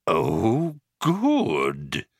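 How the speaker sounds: drawn-out, astonished alien; understated astonishment, falling low at the end. A narrator's reading. Vogon reacting to unexpected praise, mildly astonished. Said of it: "he whirred, in considerable astonishment".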